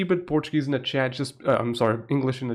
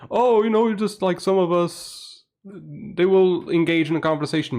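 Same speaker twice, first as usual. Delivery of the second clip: high, louder mock-whiny voice; mocking the moderator; 7 semitones higher, 4 dB louder than usual. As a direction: parody a smug moderator brushing it off. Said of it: "and the mod was like".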